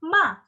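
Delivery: "ma" short, falling sharply from high; quick downward "ma"; fourth-tone MA. Shown as a demonstration. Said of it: "nada empat yang terakhir".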